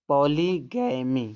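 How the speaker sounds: पॉलीगैमी said slowly, every syllable clear, ending on a long ई; teacher models पॉलीगैमी slowly, ending on a long ई; slow word demo. Shown as a demonstration. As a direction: spell out पॉलीगैमी slowly for the class. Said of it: "वाई को उच्चारण देखो बड़ी ई निकल रहा है".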